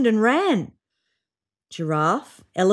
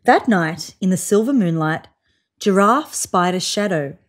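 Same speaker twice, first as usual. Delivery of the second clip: usual speaking voice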